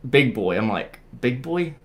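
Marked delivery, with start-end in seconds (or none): 1.2-1.7 put-on higher, questioning repeat of BIG BOY; puzzled echo question. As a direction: echo it back as a baffled question. Puzzled echo of her answer.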